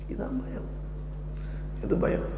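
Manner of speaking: a soft, awed whisper. Acted. Preacher defining fear for the congregation, whispered. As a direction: breathe it out as a solemn truth.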